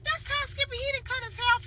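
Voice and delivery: excited recognition. Retold or imitated. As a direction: squeal it out as you recognise him.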